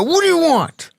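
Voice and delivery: high, sharp retold challenge; squaring up to a stranger, a mock tough bark; mock tough "What do you want?", pitched high. Confronting inmate in prison cafeteria.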